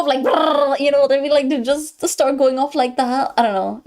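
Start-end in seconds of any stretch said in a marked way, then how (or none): 0.2-0.8 rolled "brrr" buzzing noise; trilled vibrating-phone noise; imitated notification buzz.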